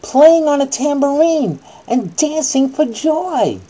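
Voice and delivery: slow, high, loud bedtime-story reading; hammed-up children's Bible story voice; dramatic storytelling. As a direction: read it like a big dramatic bedtime tale.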